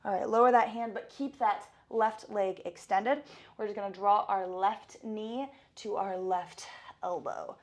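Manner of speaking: loud, fast workout coach; fitness instructor. Acted. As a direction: pump up the class. Leads a workout warm-up.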